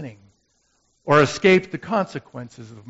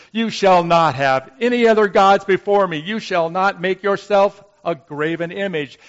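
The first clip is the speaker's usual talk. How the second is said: stern recitation; 5 semitones higher, 9 dB louder than usual. Retold or imitated. Brother reciting the commandments.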